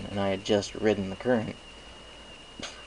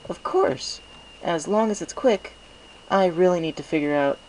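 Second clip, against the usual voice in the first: put-on higher, eager young scholar mare; Twilight Sparkle apologetic; 9 semitones higher, 4 dB louder than usual. A narrator's reading. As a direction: apologize quickly, a bit flustered. Twilight voiced.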